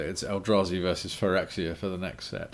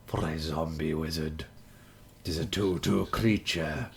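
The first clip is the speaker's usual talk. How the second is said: ancient rasping undead king; 4 semitones lower than usual. Acted. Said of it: "in my Dimir voice".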